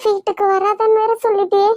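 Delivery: rising distress, almost crying; tearful pleading. Acted. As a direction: plead with your sister, wounded. Sister rejected, pleading tearfully.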